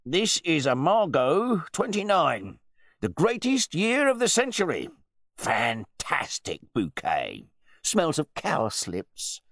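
high-pitched, gushing dinner host; self-important connoisseur in full flow; pompous wine bore. A narrator's reading. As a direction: show off the wine with booming relish. Pompous host praises his wine.

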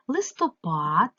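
"листопад" slow, with a long, rising "пад"; stress on last syllable. Shown as a demonstration. Teacher checking for unstressed vowels, stressing the last syllable. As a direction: stretch the final syllable of "листопад". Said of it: "не наголошений".

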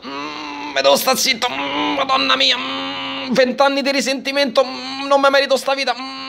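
mock-crying husband, moaning between words; whimpering Ned. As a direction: play Ned swallowing his sobs. Voicing Ned holding back tears.

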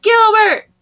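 loud, high name call; mock angry shout. Knocked-down girl calling out the boy responsible, mock angry. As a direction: yell for the culprit.

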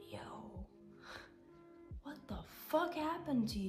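whispered yo, then a high shocked question. Retold or imitated. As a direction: ask your own reflection in disbelief. Asking her past self.

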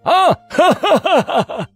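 high, loud sneering voice ending in a laugh; a high taunting rider who bursts into loud laughter; mocking taunt. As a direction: jeer at them from horseback, cackling. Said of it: "口中兀自讥嘲".

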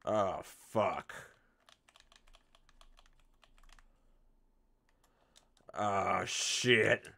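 loud startled cursing; alarmed yelling. Real, not acted. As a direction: yell in panic. Sudden danger in game.

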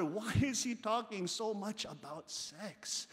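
ordinary talk

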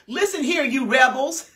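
loud, high angry rebuke; angry Moses. Acted. Moses scolding the rebellious people, furious shout. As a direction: bark at the rebels in fury. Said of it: "You can hear the anger".

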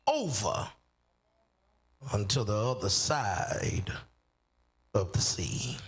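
slow, drawn-out scripture reading; solemn scripture reading. Acted. Preacher reading the verse to the congregation, slow and solemn. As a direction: read the verse with weight and space.

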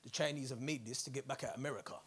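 low, soft, knowing conspiracy-theorist voice. Retold or imitated. Comic mocking conspiracy theorists who blame China, hushed and knowing. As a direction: lean in like you're sharing a secret theory. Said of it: "They're like".

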